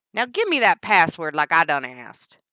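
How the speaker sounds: loud twangy demand; Danny's twang. Acted. Danny demanding the password, loud twang.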